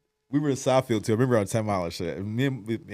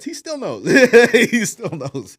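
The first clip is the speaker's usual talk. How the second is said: much higher, slow laughing repeat; 12 semitones higher, 12 dB louder, slower than usual. Real, not acted. Telling his co-host about Gene, laughing. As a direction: crack up while insisting.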